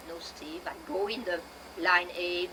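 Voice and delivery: normal talking voice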